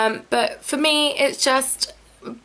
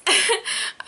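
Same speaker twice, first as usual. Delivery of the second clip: embarrassed giggle; 8 semitones higher than usual. Real, not acted. Laughs after hiccup.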